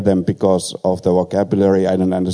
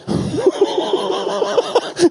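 squealing laugh at his own story; high burst of laughter. Real, not acted. Preacher laughing at the barber story punchline.

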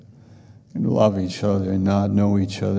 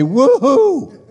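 high, loud jubilant woohoo; a far higher cry of delight; joyful whoop; 6 dB louder than usual. Pretend. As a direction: let out a whoop of pure joy. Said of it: "and you'll say".

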